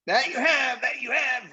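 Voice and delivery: high, eager chorus of agreement; enthusiastic roar. A narrator's reading. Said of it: "roared the other voices with great enthusiasm".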